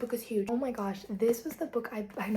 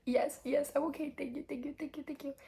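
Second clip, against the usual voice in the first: grateful, teary voice; 5 semitones higher than usual. Retold or imitated. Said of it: "He's like".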